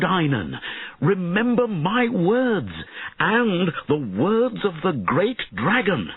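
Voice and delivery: high, drawn-out earnest plea; urgent pleading. A narrator's reading. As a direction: plead with a wayward pupil. Mentor pleads with his fallen pupil.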